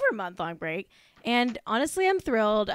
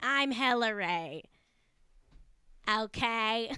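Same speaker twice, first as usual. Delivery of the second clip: put-on slow, broad Boston accent; mock Boston accent; 5 dB quieter, slower than usual. Host signs off as the celebrity, mock Boston accent. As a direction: drag it out in Bostonian. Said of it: "in a Boston accent".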